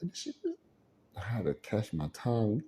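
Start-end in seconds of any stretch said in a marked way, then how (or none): 0.0-0.6 a very high, stunned half-question; mock outrage, caught mid-word; shocked disbelief. Disbelief at being scooted.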